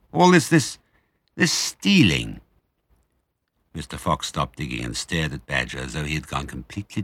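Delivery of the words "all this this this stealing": uneasy. A narrator's reading. Badger troubled.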